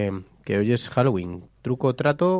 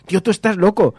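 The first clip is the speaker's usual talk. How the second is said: higher, louder, teasing disbelief; incredulous friend; 7 semitones higher, 5 dB louder than usual. Retold or imitated. Friend telling him he's crazy, higher and louder.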